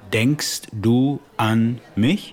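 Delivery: slow, clear "Denkst du an mich?" with a rise on "mich"; teacher repeating slowly for a confused learner. Shown as a demonstration.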